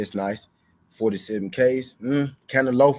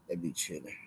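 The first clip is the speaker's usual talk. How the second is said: muttered aside; 4 semitones lower, 13 dB quieter than usual. Real, not acted. Muttered repeat.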